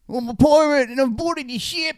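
high-pitched mock South African pirate; hammed-up South African accent, pitched right up. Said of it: "South African accent to be like".

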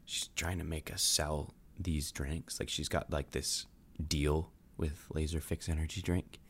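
conspiratorial low whisper-like voice; hushed. Real, not acted. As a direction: keep it down so she can't hear. Secret talk while Kayla plugs ears.

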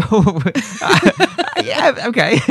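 laughing through the words, high and loud. Real, not acted. Host's crude jab at an old critic, laughing reply. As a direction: crack up while giving in.

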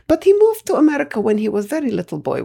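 put-on high, Russian-accented old woman explaining earnestly; granny's Russian accent. Granny telling Masha Jerry Springer's backstory, Russian-accented and earnest. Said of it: "So she would say".

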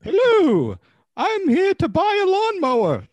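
high, loud and bright shopper; chirpy lady come to buy; high customer voice. Acted. New customer character arrives.

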